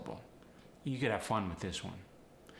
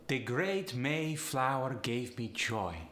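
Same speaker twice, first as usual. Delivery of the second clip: slow Polish accent, upward inflection; 2 semitones higher, slower than usual. Shown as a demonstration. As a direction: Polish lilt lifting each diphthong. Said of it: "upward inflection with these sentences".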